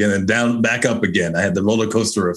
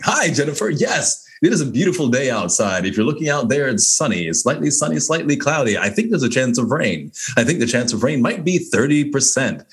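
higher, booming announcer intro; 4 semitones higher than usual. Pretend. Pretending to meet Jennifer at a party, announcer voice. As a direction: greet a stranger like a game-show host. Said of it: "but maybe just keep going".

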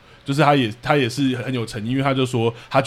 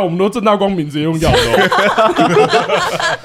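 slow, through laughter; laughing, drawn out; 6 semitones higher, 5 dB louder, much slower than usual. Real, not acted. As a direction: stretch it out as a joke, laughing. Joking defence, laughing. (笑着慢慢拉长说)

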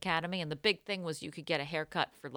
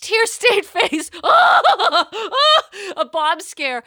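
high, loud laughing through words; 12 semitones higher, 13 dB louder, slower than usual. Real, not acted. Laughing at her own disaster story, high and loud. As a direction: crack up at the memory.

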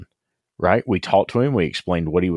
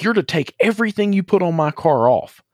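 mock angry yell; 10 semitones higher than usual. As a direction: fire it off like an outraged young customer on the phone. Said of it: "the kid calls me screaming".

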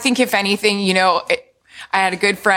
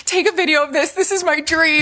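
higher, eager plea; excited; 8 semitones higher than usual. Retold or imitated. Mom telling husband to film her watching football, eager. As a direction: gush as if living a dream.

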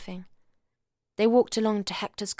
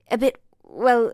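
hesitating, slow, searching for words; hesitant; 4 semitones higher, 10 dB louder, slower than usual. A narrator's reading. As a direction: stall before the hard part. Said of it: "Hamish hesitated".

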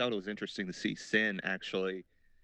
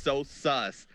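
ordinary talk

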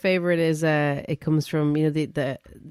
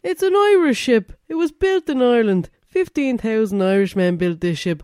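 high, loud mock Irish accent; hammed-up Irish lad, Irish accent; 4 semitones higher, 5 dB louder than usual. Podcaster imitating the Irish lad on the ship, Irish accent. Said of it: "And he's like".

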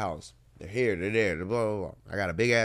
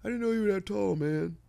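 much higher, mocking a surprised fan; mock-surprised stranger, pitched way up; mocking people; 12 semitones higher than usual. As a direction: imitate the people who keep saying it. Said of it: "Tired of people saying that shit".

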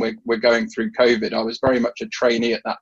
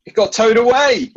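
laughing punchline; 11 semitones higher, 5 dB louder than usual. Real, not acted. Punchline of his frog joke.

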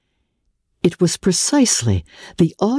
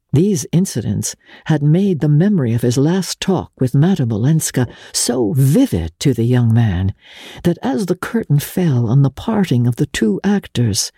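ordinary delivery

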